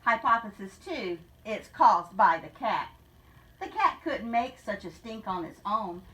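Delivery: rhythmic storybook read-aloud; story narration. Acted. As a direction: read the rhyme to the class with a lilt. Teacher reads picture book aloud.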